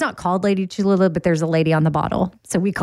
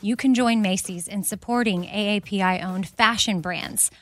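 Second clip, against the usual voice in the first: upbeat sponsor read, quick and bright; host reading a Macy's sponsor spot; 4 dB quieter than usual. Acted. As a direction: sell it like a podcast ad.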